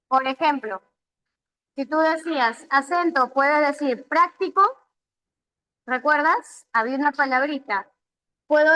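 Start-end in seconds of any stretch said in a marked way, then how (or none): 4.1-4.6 práctico stressed on its first syllable, PRÁC-ti-co; práctico with the weight up front on PRÁC. Shown as a demonstration. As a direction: hit the PRÁC of práctico, let the rest follow. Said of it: "es la mayor fuerza de voz".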